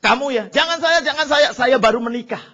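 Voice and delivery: menolak panik, keras dan bernada tinggi; pura-pura takut. Acted. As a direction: meniru pengawal panik melempar tugas. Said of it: "Waduh, pada takut". (menolak ketakutan dengan suara lantang)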